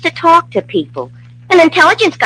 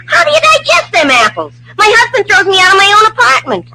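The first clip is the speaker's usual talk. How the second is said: much higher, exasperated cry of outrage; wife fuming to her neighbor, rising indignant shout; indignant outburst; 8 semitones higher than usual. Real, not acted. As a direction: let the indignation burst out. Indignant wife retelling insult.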